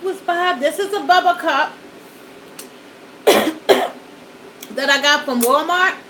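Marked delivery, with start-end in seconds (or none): 3.2-3.9 breathy throat-clearing cough; lingering cough after choking; small cough. Real, not acted.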